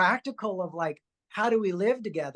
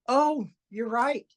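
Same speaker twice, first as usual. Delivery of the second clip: slow realization; 6 semitones higher, much slower than usual. Retold or imitated. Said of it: "And I'm like".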